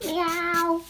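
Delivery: a high, soft cat meow from the alien. Pretend. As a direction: mew like a little alien kitten. Voicing whiskered alien creature.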